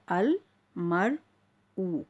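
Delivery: slow "अल", "मर", then "ऊ" after a pause; separated syllables. Shown as a demonstration. Teacher repeating it for learners, slowly broken apart. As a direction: leave gaps between "अल", "मर" and "ऊ". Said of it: "बिल्कुल अलग-अलग करके पढ़ रही हूँ".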